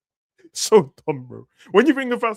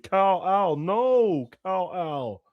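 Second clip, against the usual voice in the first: a hint of Gal Gadot, slightly higher, drawn out; mild mock Gal Gadot; 7 semitones higher, much slower than usual. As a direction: lightly imitate her pleading Kal-El. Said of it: "she said in Justice League".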